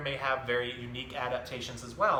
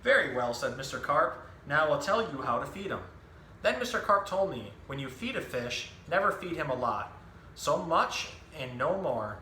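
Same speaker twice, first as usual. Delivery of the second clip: lively picture-book storytelling voice, each line clearly paced; reading to children; 3 semitones higher than usual. Acted. Zookeeper reading a book to children, animated storytelling. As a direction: tell the story to a room of young kids.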